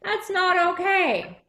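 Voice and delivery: mildly voiced disapproving townsfolk; disapproving onlookers. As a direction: play the upset crowd, lightly. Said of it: "they said".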